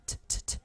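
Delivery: soft, voiceless puffs of "ঠ"; light T sound. Shown as a demonstration. Teacher isolating the final sound, light voiceless T. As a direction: tap "ঠ" out with breath only, no vowel. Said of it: "হালকা ঠ চলে আসে".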